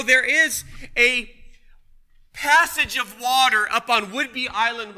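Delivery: loud, driving sermon voice; rising preacher's call; full-force preaching. Acted. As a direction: preach it out to the back row. Preaching loudly to congregation.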